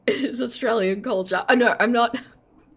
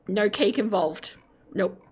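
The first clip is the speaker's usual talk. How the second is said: ordinary talk, nothing marked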